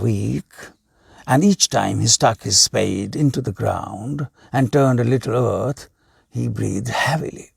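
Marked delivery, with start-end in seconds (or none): none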